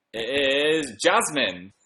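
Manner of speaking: suspenseful drawn-out name reveal; grand reveal. Real, not acted. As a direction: draw it out like announcing a winner. Announces the guinea pig's chosen name.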